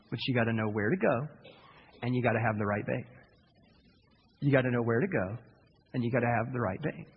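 low, soft secret-sharing; confiding secret. Retold or imitated. Fisherman confides his secret to the pastor, low and soft. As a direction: lean in and tell the secret.

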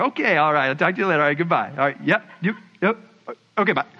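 low, mumbly string of sign-offs; awkward phone goodbye. Acted. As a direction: act out struggling to hang up, pitched low. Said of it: "getting off the phone is weird".

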